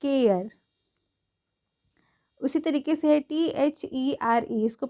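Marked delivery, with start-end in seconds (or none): 0.0-0.5 "केयर" as kay-ar, falling at the end; correct pronunciation. Shown as a demonstration. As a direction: model "केयर" on its own for learners. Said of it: "केयर मैंने लिखा है".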